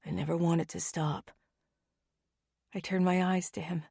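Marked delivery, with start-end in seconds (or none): 0.0-1.3 soft, low, hushed murmur; much softer, intimate murmur. Acted. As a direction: murmur it tenderly under the breath. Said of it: "Roman whispers".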